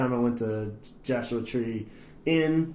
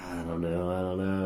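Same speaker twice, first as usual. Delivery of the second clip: low, quiet reluctant mumble; antisocial reluctance; 6 semitones lower, 8 dB quieter than usual. Retold or imitated. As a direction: hang back, keeping it low and soft. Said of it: "I was all just being antisocial".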